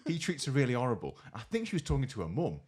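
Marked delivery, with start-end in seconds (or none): none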